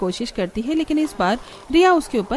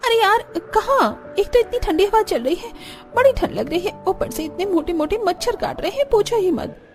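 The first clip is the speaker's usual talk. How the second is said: put-on high-pitched, exasperated young wife grumbling; complaining village daughter-in-law; 8 semitones higher than usual. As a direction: grumble to a friend in a raised pitch. (परेशान बहू की शिकायती आवाज़)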